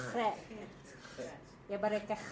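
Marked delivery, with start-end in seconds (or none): 0.1-0.4 Chet opened with a throaty "kh"; guttural Chet. Shown as a demonstration. Teacher repeating the guttural, Chet with a rasped "kh". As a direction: scrape the start of Chet at the back of the throat. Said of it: "notoriously a guttural".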